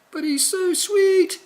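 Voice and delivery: very high, slow, gushing coo; goofy witch voice. Acted. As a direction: swoon over the baby dragon. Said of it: "says the witch".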